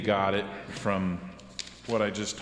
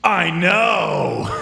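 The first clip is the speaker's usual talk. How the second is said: much higher, louder yell; shout; 7 semitones higher, 6 dB louder than usual. Acted. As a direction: belt it out. Character shouts in cartoon.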